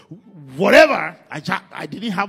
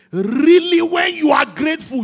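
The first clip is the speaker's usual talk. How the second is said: preacher's shout; 10 semitones higher, 10 dB louder than usual. Acted. Preacher urging loud gratitude.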